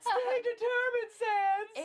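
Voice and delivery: high, slow, quivering mock cry; mock tearful. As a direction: sob the line over the top. Reading game line dramatically.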